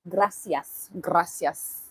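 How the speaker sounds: best attempt at a Spanish accent. Shown as a demonstration. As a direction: thank them in a put-on Spanish accent. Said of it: "That's my best Spanish accent".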